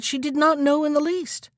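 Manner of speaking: plain reading voice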